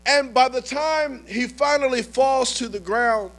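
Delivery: usual speaking voice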